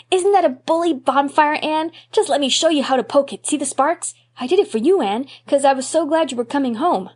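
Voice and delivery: put-on high, loud eager boy voice; a small boy showing off, thrilled; excited little boy. Davy excitedly shows his bonfire.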